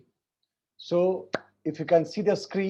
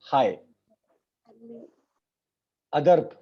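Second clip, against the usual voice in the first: usual speaking voice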